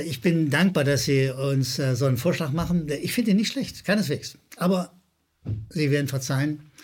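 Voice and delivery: ordinary delivery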